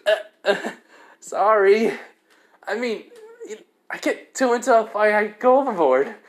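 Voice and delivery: high-pitched, halting excuse; acted sheepish apology. Issei excusing himself to Kaneko, sheepish. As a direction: stumble through a mock apology, voice up. Hero apologises after being scolded.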